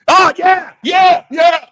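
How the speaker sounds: high, loud, hammed-up cry of ecstasy; mock churchgoer shout. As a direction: belt it out like an over-fervent worshipper.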